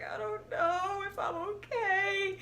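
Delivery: high, soft, slow whimpering; mock whimper. Her answer to bandmates while lying on the field, high soft whimper. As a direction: whine it out, pitiful and near tears.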